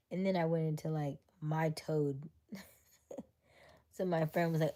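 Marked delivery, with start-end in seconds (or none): none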